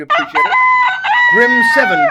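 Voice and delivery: very high squeak of joy; squeaky voice. Pretend. Streamer squealing in a cartoon-high voice over finishing.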